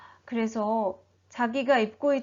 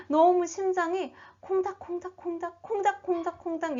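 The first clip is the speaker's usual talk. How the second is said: higher-pitched excited pitter-patter; pounding heartbeat; 7 semitones higher than usual. A narrator's reading. Storyteller shows children how Bartimaeus's heart raced, excitedly. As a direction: mimic a racing heart in a high voice. Mimics a racing, thumping heart.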